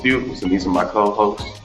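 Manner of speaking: ordinary talk, nothing marked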